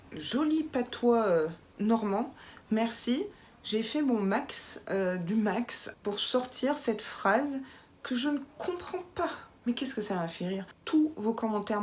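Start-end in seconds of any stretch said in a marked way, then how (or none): none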